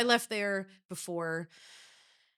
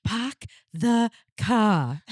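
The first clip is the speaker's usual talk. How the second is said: slow, loud Boston accent, no R's; 4 semitones higher, 6 dB louder, much slower than usual. Shown as a demonstration. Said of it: "training him on how to not use R's".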